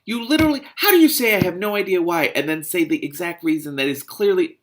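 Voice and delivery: loud, high-pitched exasperation; incredulous yelling. Real, not acted. Reader argues with the character, incredulous yelling. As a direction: explode in disbelief, easing slightly at the end.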